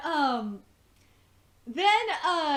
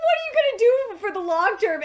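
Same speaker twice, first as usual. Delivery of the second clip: laughing quote; 9 semitones higher, 7 dB louder than usual. Retold or imitated. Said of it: "he's like".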